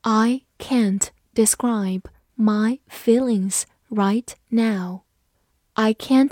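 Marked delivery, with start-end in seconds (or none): 0.0-5.0 slow, separated words; unhurried teacher modelling, pausing between words; slow read-along. Shown as a demonstration. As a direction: read slowly so learners can follow. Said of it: "跟着我慢读一遍".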